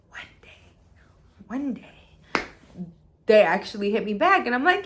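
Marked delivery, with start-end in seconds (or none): none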